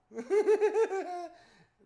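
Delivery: loud, high bursting laugh; big burst of laughter. Real, not acted. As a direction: crack up at the memory. Laughing at own fright.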